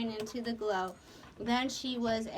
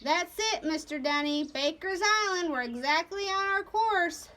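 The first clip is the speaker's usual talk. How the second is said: raised, higher-pitched captain's voice; Nate's call; 6 semitones higher, 3 dB louder than usual. A narrator's reading. Captain confirming the course to his mate, raised voice. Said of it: "they heard Nate's voice".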